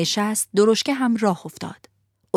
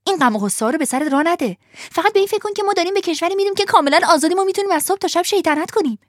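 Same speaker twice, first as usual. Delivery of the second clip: put-on fast, higher, bubbling boy's voice; excited urging; 10 semitones higher, much faster than usual. Lampwick urging Pinocchio to come, fast and excited. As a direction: rush him into saying yes.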